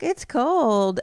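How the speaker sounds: high-pitched mock child complaint; little kid voice. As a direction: imitate a small boy complaining. Voices small child Mac complaining.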